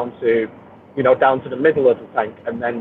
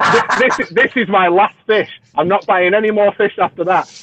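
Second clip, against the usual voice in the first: higher-pitched mock vow through giggles; laughing mimicry; 6 semitones higher than usual. Mocking hobbyists swearing off more fish, laughing. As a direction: imitate an empty promise, amused.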